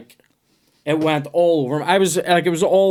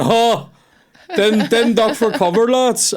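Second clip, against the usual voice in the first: much higher, slow scoffing officer voice; hammed-up scoffing officer; mock officer; 9 semitones higher, slower than usual. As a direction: play the callous officer. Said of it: "and then be going".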